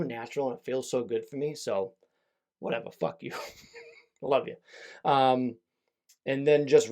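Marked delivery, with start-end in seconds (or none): 2.6-4.6 playful whispered jab; joking whispered aside. Acted. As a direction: toss it off under the breath, teasing. Joking aside to his uncle.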